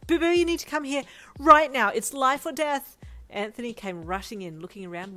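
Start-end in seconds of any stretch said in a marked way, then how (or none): 0.1-3.0 high, loud, frantic squeal; fussy friend calling his partner, high panicked squeal; squealing Brian. A narrator's reading. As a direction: shriek for Anthony like it's an emergency. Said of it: "he squealed".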